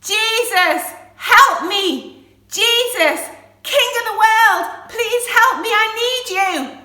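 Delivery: loud, high-pitched cry for help; full-voiced pleading yell; desperate shouting. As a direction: shout out to be heard down the road. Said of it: "he started shouting".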